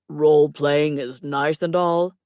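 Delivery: slow, loud drawn-out delivery; drawn-out theatrical complaint; complaining character voice. A narrator's reading. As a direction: play him loud and unhurried, mock-aggrieved. Clay's put-on voice, complaining.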